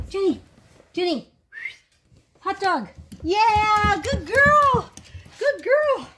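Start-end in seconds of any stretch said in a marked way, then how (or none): 3.2-6.1 high, swooping cheer for a dog; excited praise. Real, not acted. Owner praising the dog mid-course, excited praise. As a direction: gush with delight.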